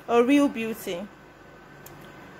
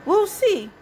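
queen's knowing thought; 8 semitones higher, 3 dB louder than usual. A narrator's reading. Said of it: "thought the queen".

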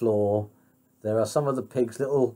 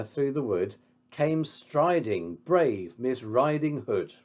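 ordinary delivery, nothing marked

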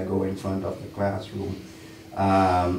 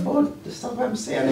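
much higher, incredulous inner voice; indignant high-pitched retort. Retold or imitated. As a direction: squeak out the disbelief. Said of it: "I'm like".

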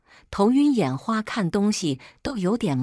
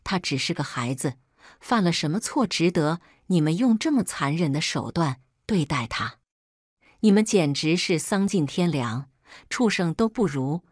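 ordinary delivery, nothing marked

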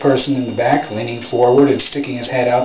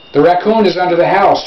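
higher-pitched call, a mild shout; excited boy's shout; 5 semitones higher, 4 dB louder than usual. A narrator's reading. Boy reporting the raccoon's hiding place to his father, mild shout. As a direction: call it out, not at full volume. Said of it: "shouted Fred".

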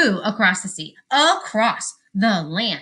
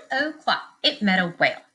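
plain reading voice

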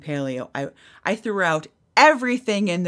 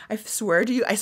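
ordinary delivery, nothing marked

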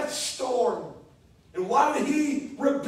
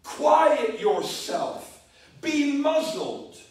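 very slow, louder, every word pressed; slowly muzzle the storm with each word; firm command; 2 semitones higher, 4 dB louder, much slower than usual. Acted. Jesus silencing the storm.

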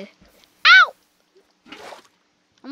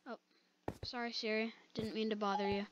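ordinary talk, nothing marked

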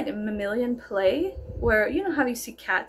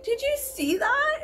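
1 excited brag; 13 semitones higher, slower than usual. Retold or imitated. Bragging to friends after surf.

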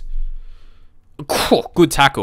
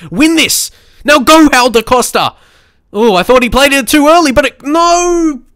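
excited urging; 12 semitones higher, 6 dB louder than usual. Real, not acted. Gamer cheering his striker forward, excited and high. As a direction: pitch jumps higher as the attack builds.

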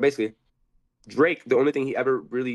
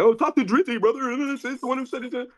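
mocking Top; 12 semitones higher than usual. Guest mocks Top passing him off to [name], high mock voice. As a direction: mimic him as a smug liar. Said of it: "He's like".

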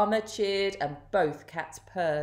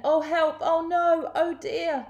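high, louder storybook panic; mock alarm; 10 semitones higher, 4 dB louder than usual. A narrator's reading. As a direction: fret in a raised, dismayed voice. Narrator voices alarm at lost eggs.

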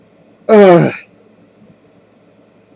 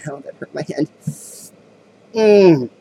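normal talking voice

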